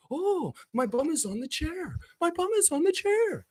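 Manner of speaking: high, soft, silly mock voice; a goofy high parody of a contented man; lightly voiced silly Tom Green. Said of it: "mocking Tom Green".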